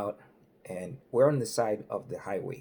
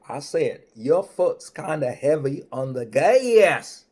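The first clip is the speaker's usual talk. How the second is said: loud, booming Southern trooper drawl; hammed-up highway patrolman; state trooper voice; 3 semitones higher, 9 dB louder, slower than usual. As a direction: play the big-voiced cop laying down the law. Trooper repeats himself slowly.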